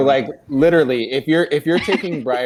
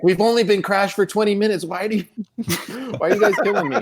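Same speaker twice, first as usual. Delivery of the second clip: pleading victim; 7 semitones higher, faster than usual. Retold or imitated. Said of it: "and he's like".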